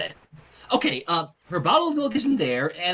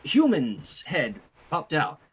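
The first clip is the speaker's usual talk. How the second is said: usual speaking voice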